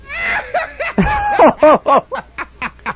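shrill squealing giggle burst; high shrieking giggle. Acted. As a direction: let out a wild high giggle. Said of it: "Let me have another giggle".

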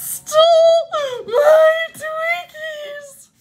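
very high, loud, slow wailing scream; little sister screaming at recess. Acted. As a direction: wail it out like a robbed child. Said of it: "Why is she screaming?".

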